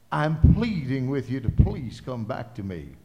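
loud, earnest plea as God; preacher voicing God's plea; loud pleading preaching. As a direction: beg them to come back, full voice.

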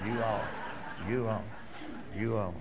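a laughing, lightly Southern "you all". Retold or imitated. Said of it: "God's Southern".

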